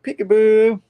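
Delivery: high, loud teasing peekaboo; mocking echo. Peter mocking Mysterio's surprise attack, high and loud. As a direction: throw the taunt right back.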